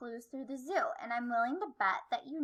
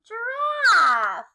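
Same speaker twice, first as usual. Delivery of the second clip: high, loud reveal; excited answer; 8 semitones higher, 8 dB louder than usual. Acted. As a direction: shout the answer out with glee.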